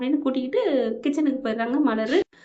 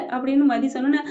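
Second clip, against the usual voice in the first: plain reading voice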